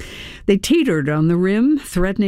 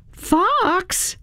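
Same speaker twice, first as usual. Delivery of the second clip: calling out; 13 semitones higher than usual. A narrator's reading. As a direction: call him sharply higher, without shouting. Calling the unresponsive fox once more.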